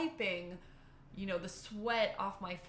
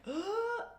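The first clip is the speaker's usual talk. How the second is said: a high gasp for air; sharp gasp; 13 semitones higher than usual. Retold or imitated. In the hot lodge, desperate high gasp. Couldn't breathe in the heat.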